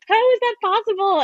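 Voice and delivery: high-pitched, astonished outburst; amazed disbelief. Retold or imitated. Quartet watching a grainy Fork video, exclaiming in amazement. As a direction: imitate awestruck singers, voice leaping way up.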